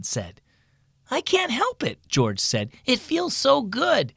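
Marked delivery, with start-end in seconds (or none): 1.1-2.0 put-on high-pitched kid's protest; protesting boy. A narrator's reading. George protests to his coach, high boyish whine. 2.8-4.1 put-on high, slow, savoring kid voice; relishing boy. A narrator's reading. George tells coach about scratching, high and relishing.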